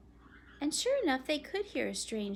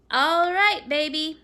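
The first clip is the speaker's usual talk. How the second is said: louder, calling up the stairs to his daughter; 6 semitones higher, 13 dB louder, slower than usual. Acted. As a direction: call up to her from outside. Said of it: "he called up to her".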